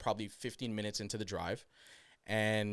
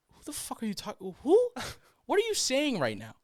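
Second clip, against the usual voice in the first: higher, incredulous mock voice; imitated confused son; 14 semitones higher, 4 dB louder than usual. Son on the phone to his mother, mock bafflement. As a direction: demand an answer, pitch jumping up. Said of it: "her son's on the phone like".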